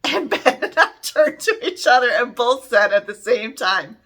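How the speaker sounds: ordinary talk, nothing marked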